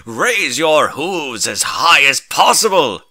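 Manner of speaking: loud, slow rallying order; heroic command. A narrator's reading. Hero orders the ponies to raise hooves, loud and commanding. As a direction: call the crowd to raise hooves.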